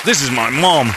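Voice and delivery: high, loud puppyish excitement; high excited daters. Retold or imitated. Mocking daters meeting the family, giddy and high. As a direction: gush like a happy dog.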